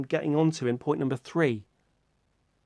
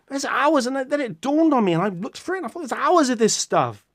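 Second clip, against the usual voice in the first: excited awe; 9 semitones higher, 4 dB louder than usual. Retold or imitated. Retelling his awe at finding YouTube.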